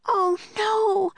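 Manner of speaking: high-pitched alarmed cry; Annie's cry. A narrator's reading. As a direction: cry out in a little girl's high voice. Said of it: "cried Annie".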